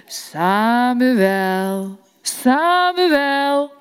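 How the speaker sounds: voice of God calling. Acted. God calls again.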